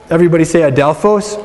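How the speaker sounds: ordinary talk, nothing marked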